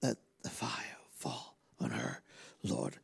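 soft, breathy low prayer; whispered prayer. Real, not acted. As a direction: pray it in a hush. Prayer softly over woman.

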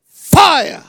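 loud, high cry; shouting fire. Real, not acted. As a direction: call down fire full force. Prayer call for fire.

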